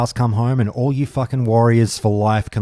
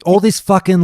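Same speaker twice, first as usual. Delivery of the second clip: angry rant; 9 semitones higher, 5 dB louder than usual. Real, not acted. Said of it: "I'm getting angry, mad".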